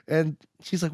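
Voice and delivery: normal talking voice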